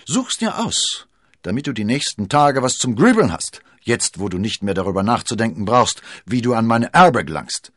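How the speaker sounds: lauter, hoch einsetzender Hohn; höhnisch. A narrator's reading. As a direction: das Gegenüber lautstark verspotten. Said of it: "ergänzte Lord Winter höhnisch". (höhnisch triumphierend)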